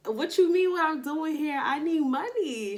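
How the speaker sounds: high, loud mock indignation; a lightly acted retold comeback; indignant retort. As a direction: push back in disbelief. Her own retold reply.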